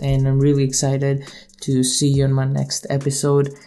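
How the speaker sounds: ordinary talk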